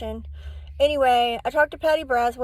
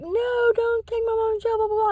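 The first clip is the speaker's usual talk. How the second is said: put-on much higher childlike voice, begging; pleading crying kids; 10 semitones higher than usual. Kids beg police not to take their mom, high pleading child voice.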